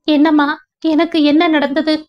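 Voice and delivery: asking in alarm, voice up high; anxious, rushed plea to mother; panicked question. A narrator's reading. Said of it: "பதறி கேட்டவளின்".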